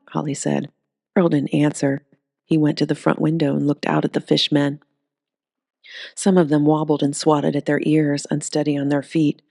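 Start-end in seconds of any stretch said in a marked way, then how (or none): none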